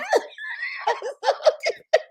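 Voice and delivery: very high, long giggle; helpless laughter. Real, not acted. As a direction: crack up at your own punchline. Cracks up at own punchline.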